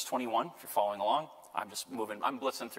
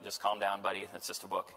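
dismissive professor; 5 semitones lower, 4 dB quieter than usual. Retold or imitated. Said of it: "And the professor's like".

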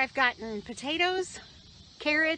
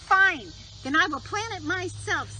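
indignant hen; 7 semitones higher than usual. Acted. Hen answers, annoyed.

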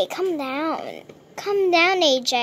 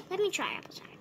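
whispered, unvoiced; 12 dB quieter, much faster than usual. Whispering to Applejack to wake her. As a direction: hush it to a whisper. Whisper after a plain try.

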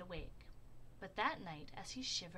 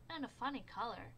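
ordinary delivery, nothing marked

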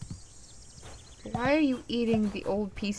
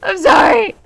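high, loud shouted apology; panicked apology; 8 semitones higher, 11 dB louder than usual. Real, not acted. Player apologising to the creature they pissed off, panicked shout. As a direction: cry out for forgiveness.

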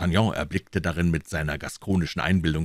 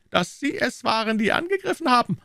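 very high-pitched king's voice; insistent king. Acted. King interrupting Treville about who attacked, insistent high voice. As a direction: make the point in a high head voice.

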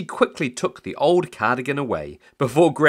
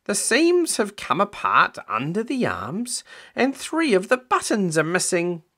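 nagging Grandma; 10 semitones higher than usual. Acted. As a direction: list faults in a high-pitched Grandma voice. Voices Grandma in the story.

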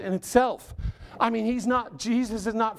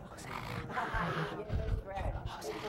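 mock whispered cheer; 12 dB quieter, much faster than usual. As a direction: whisper the cheer as if afraid to startle. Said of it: "those who followed whispered quietly".

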